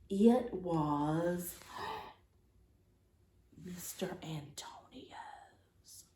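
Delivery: gasp, then whisper the name; breathless hushed reveal; shocked whisper. Acted.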